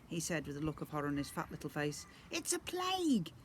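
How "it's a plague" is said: very high fearful goblin voice; horrified squeak. Acted. Goblin prince sees Dad and recoils, horrified squeak. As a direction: squeak it in disgust.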